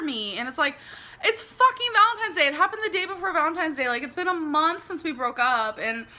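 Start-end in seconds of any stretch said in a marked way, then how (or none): 1.2-5.8 raised voice in frustration; venting loudly, voice climbing high; upset. Real, not acted. Venting about the breakup.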